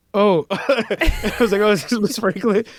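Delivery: high, laughing mock astonishment; sudden dawning realisation. Musician retelling his realisation it is the singer calling, amused surprise. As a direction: replay the stunned penny-drop moment with laughter.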